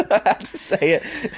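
high, slow laughing apology; squeaky words breaking into laughter. Real, not acted. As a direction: say it through giggles. Laughing after a cheeky lesson.

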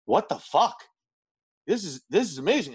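mock stunned disbelief; amazed reaction. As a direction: imitate friends floored by a first sip. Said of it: "everybody's face immediately just goes".

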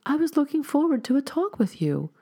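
high, coaxing plea; pleading. A narrator's reading. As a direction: coax her to stay, pitch well up. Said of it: "pleaded the stranger".

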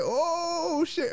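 high, stretched-out "oh" of alarm; retold shock. Everybody looking at him after her outburst, mock alarm. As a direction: draw it out high, as if caught out. His shock at the public scene.

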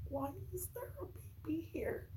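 hushed, breathy whisper. Retold or imitated. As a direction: whisper it in disbelief. Forager finding the baby.

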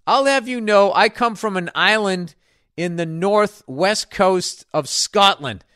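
ordinary delivery

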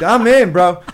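high, loud thrilled burst; shouting with glee. Retold or imitated. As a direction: blurt it out overjoyed. Thrilled reaction to Buddy's song.

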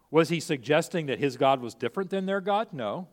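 normal talking voice

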